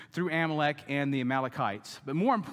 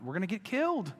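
a touch of panic, slightly higher; mock panic; 4 semitones higher, faster than usual. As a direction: start the alarm understated. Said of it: "crying out".

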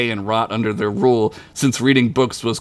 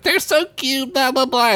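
mocking cousin; 11 semitones higher than usual. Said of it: "he was like".

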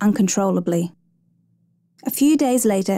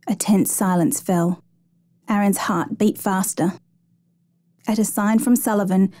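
plain reading voice

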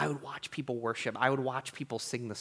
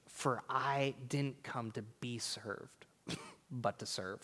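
hushed quote; 8 dB quieter, slower than usual. Acted. Quoting Jesus softly.

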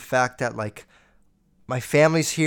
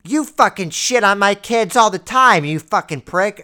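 raised, louder mock yell of an irate mom; imitated screaming mom; 5 semitones higher, 7 dB louder than usual. Mom lashing out at uncle over her kids, imitated yelling. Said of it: "I could hear screaming the whole time".

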